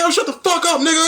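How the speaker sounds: high, playful mock yell at his buddy; mock yelling at friend. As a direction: bark it like a friendly fake fight. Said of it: "be yelling and cursing".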